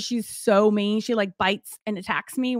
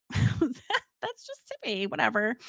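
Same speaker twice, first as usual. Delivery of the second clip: mock casual; 6 dB quieter, slower than usual. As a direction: play it cool, pretending nothing's odd. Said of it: "gonna play it cool and be like".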